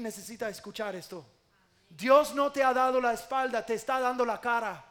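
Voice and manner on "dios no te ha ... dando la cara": loud, raised-pitch proclamation; full-force preaching. Real, not acted. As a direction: drive the assurance home to the congregation. (prédica enérgica a plena voz)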